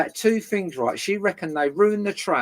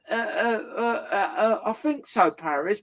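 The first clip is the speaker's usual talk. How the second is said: mock stammering neighbour; 4 semitones higher than usual. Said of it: "She's gone".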